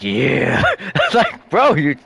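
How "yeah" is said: deadpan tough guy. Retold or imitated. Imitating tough guy's reply.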